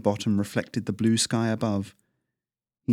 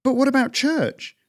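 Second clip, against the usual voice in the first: put-on much higher young voice; boy's protest; 4 dB louder than usual. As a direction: ask it as a worried kid. Kai questions father.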